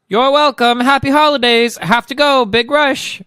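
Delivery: loud, high-pitched put-on character; hammed-up high comic-book voice; hurried heroine voice. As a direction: call it out cheerily as you dash off.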